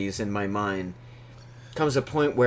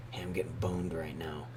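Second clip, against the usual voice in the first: whisper; 6 semitones lower, 14 dB quieter than usual. Retold or imitated. Caller voiced in phone story.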